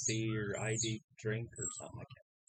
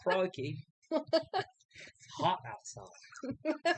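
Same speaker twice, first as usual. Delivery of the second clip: higher-pitched, put-on Australian accent; mock Australian accent; 12 semitones higher, much slower than usual. Answering whether they sound like they're from Australia, mock Australian accent. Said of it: "Do we sound like we're from Australia".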